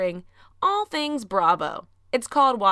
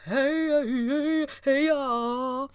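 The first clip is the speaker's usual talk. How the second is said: hype greeting; 6 semitones higher, much slower than usual. Acted. Podcast intro call-and-response greeting.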